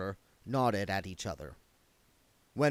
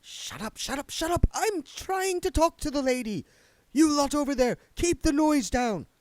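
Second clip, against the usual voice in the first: much higher, snappish ghost hushing others; irritable spirit guide; 5 dB louder than usual. Acted. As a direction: shush the crowd of spirits impatiently.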